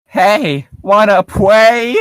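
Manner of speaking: put-on high, slow sing-song child; mock the kid's sugary invitation; cutesy kid voice. Mocking the cutesy kid, slow and sing-song.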